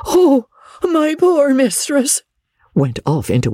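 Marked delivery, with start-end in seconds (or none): 0.1-2.2 high, slow wailing lament; cried out. A narrator's reading. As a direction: wail it high and drawn out for the mistress. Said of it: "Pauline cried out".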